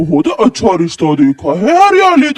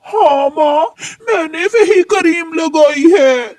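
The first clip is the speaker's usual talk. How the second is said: crying; 6 semitones higher than usual. Acted. Daughter admits using the cream.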